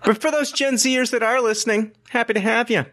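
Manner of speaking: sarcastically gushing hello; mock sweet welcome. Mock welcome to Gen Z listeners.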